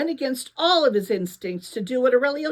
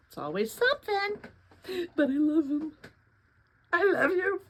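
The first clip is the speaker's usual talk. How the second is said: talking to the cat; 8 semitones higher than usual. Real, not acted. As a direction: coo at the cat, much higher. Speaker fondly talks to her cat.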